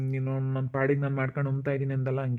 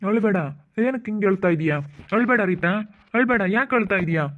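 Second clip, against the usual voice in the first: higher, louder pleading not to cry; urgent comforting; 6 semitones higher, 3 dB louder than usual. Acted. Friend pleading with crying Reeta, higher and louder. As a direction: rush in to stop her tears.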